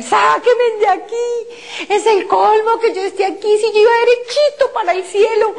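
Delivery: high, tearful cry to be let out; high sobbing plea to be taken out; desperate crying plea. Retold or imitated. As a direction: beg through tears, voice pitched high. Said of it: "a defender mis derechos y empecé a decir".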